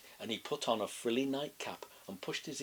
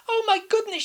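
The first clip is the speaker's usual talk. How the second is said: very high, loud frightened granny; panicked shriek; 11 dB louder than usual. A narrator's reading. Grandma seeing the wolf, high terrified shriek. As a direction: shriek it in terror.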